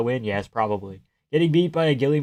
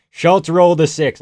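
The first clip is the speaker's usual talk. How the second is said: excited shout; 4 semitones higher, 9 dB louder than usual. Real, not acted. Hyped reaction to Schultz's win.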